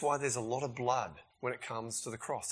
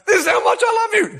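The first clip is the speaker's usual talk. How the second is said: melodramatic friend; 14 dB louder than usual. Retold or imitated. Aaron's dramatic declaration.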